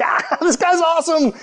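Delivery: high-pitched cracking-up delivery; laughing. Retold or imitated. Preacher acts out his student self laughing at the professor, high laughing voice. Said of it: "'cause I'm laughing".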